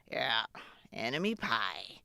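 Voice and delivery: low, contented dad voice; satisfied dad. Acted. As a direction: savor the name like a secret plan. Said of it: "he said, satisfied".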